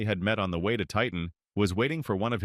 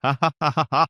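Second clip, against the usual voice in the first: loud, higher ha ha ha laugh; jeering laughter; 6 semitones higher, 7 dB louder than usual. A narrator's reading. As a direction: laugh him off, mocking. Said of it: "comrades laughed".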